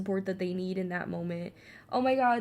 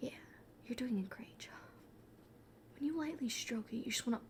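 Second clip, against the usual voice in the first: soft breathy painting whisper; whispered soothing painting lesson; Bob Ross whisper; 12 dB quieter than usual. Pretend. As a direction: coax them gently like a TV painter. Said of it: "I'm Bob Ross now".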